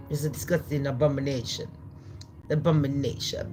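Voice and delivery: low, soft South African take on "abomination"; fast, low South African mimicry; South African accent. Shown as a demonstration. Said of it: "I do the South African talk".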